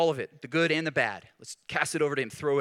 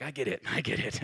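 laughing sympathy; 4 semitones lower, 4 dB quieter than usual. Real, not acted. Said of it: "I'm like".

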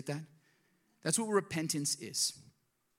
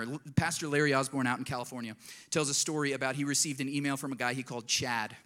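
normal talking voice

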